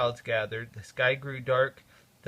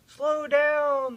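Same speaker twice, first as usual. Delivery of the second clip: loud, higher warning cry; urgent call; 13 semitones higher, 8 dB louder than usual. A narrator's reading. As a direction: shout the warning to slow. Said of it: "the driver said".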